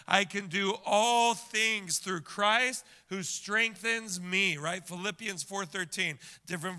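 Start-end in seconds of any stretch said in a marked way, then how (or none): 0.1-4.6 slow, weighty verse recital; slow emphatic recital. Shown as a demonstration. Pastor modelling the verse for the church, slow and weighty. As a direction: lay out every word slowly. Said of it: "Everybody say it like I said it".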